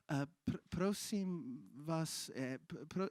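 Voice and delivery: slow, halting, soft Slovak request; timid broken Slovak. Retold or imitated. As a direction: stammer the Slovak like a nervous foreigner. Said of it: "I don't speak Slovak very well".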